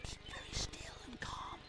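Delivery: breathy whispered line; soft urgent whisper. A narrator's reading. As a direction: hush it so the insect stays calm. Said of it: "whispers Rupert".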